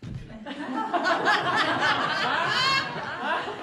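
high rising laugh at himself; burst of laughter. Real, not acted. Pastor laughing at his own story, high laugh.

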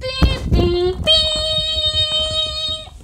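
high held beep sound; censor beep. Pretend. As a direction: hold a high beeping tone.

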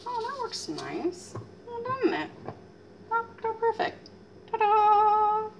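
high, pleased sing-song; delighted. Real, not acted. Cook shows viewers the cored apple ring, high and delighted. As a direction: show off the result with glee.

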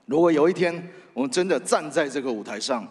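ordinary delivery

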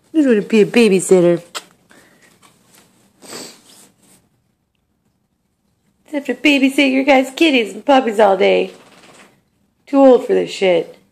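mock indignant. Owner grumbles loudly for old cat Jonas. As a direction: rant on the old cat's behalf.

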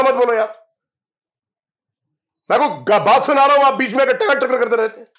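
angry scolding. Retold or imitated. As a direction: snap at someone who keeps interrupting. Scolding an interrupting listener. (गुस्से में ऊँची, तेज़ आवाज़ में डाँटना)

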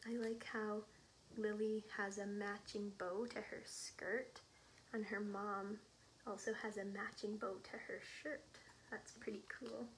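usual speaking voice

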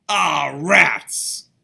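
loud fed-up outburst; boy loudly cursing the broken press; frustrated cry. Acted. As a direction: blurt it out in frustration. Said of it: "cried Orville".

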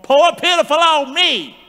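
loud, high self-pitying wail; hammed-up groan of self-pity; mock moaning. Preacher imitates griping Israelites, mock moaning. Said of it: "they moaned, they groaned".

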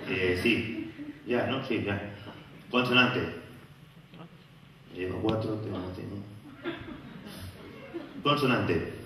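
low, soft dazed game-show player; a hint of a confused quiz contestant; mumbling contestant.

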